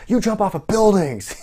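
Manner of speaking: high, loud imitation of an outraged yell; Kevin Owens yelling. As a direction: mimic a wrestler yelling at an opponent in disbelief. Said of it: "Kevin yelling at Shane".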